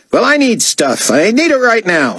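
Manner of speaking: high, petulant diva demand; demanding artiste. A narrator's reading. Artiste demanding supplies from David, petulant. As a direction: insist in a high, impatient voice.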